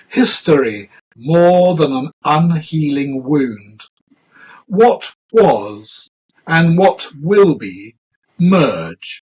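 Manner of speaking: measured, deliberate poem reading with falling cadences; poetry recitation. Acted. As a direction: recite it line by line, weighting each image and pausing between phrases.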